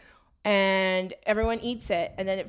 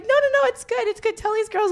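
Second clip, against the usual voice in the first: insistent mom; 12 semitones higher than usual. Retold or imitated. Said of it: "And she was like".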